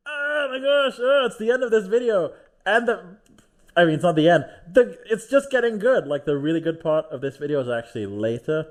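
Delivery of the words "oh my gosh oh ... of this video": high, loud hammed-up alarm that the video is ending; mock surprise. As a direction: gasp like you just noticed the clock ran out. Sudden mock alarm at video end.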